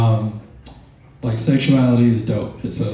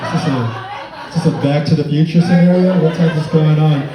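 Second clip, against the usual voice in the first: higher, louder disbelieving cop rant; stunned cop who lost the car, pitched up; bewildered cop; 4 semitones higher, 3 dB louder, faster than usual. Retold or imitated. Cop wondering if he chased a DeLorean, louder and higher. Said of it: "He's like".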